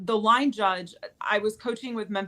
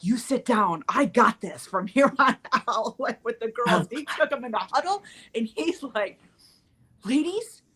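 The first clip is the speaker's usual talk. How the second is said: rally the team like an assistant coach who has had enough; assistant coach taking charge; 5 semitones higher than usual. Retold or imitated. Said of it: "he was like".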